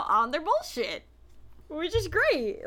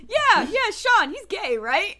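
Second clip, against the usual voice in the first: loud, higher, amused quote; excited retelling; 6 semitones higher, 7 dB louder, slower than usual. Retold or imitated. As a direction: blurt it out while tickled by it. Retelling guest's offhand remark, laughing excitedly.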